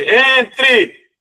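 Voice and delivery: high, loud "Entre!" from inside; shouted call. Acted. Bishop calling the knocking stranger, loud shout. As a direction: call him in from behind the door. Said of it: "uma voz ... que grita assim".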